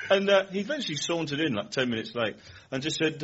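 normal talking voice

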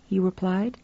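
usual speaking voice

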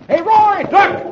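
high, loud, urgent shout; warning shout. A narrator's reading. Brad to Roy as the mule charges, urgent shout. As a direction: yell a sudden warning across the field. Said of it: "Roy hears Brad shout".

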